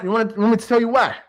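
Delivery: usual speaking voice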